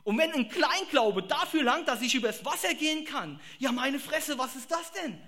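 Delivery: high, loud marveling outburst; excited preaching. Real, not acted. Preacher marveling at little faith walking on water, loud excited. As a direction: burst out in wonder.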